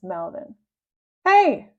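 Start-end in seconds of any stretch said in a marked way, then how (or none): 1.2-1.7 high, loud indignant cry; an angry teacher spotting him. Acted. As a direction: call out in outrage. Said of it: "cried Miss Anthrop".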